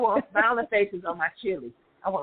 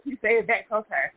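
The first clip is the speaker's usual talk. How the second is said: ordinary talk, nothing marked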